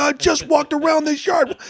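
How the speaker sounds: outraged shout at the guard; retold outburst. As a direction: bellow it like you can't believe it.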